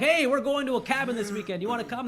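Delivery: high, bubbly invitation; cheery teen invite. Retold or imitated. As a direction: chirp it like an eager teen. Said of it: "we're just like".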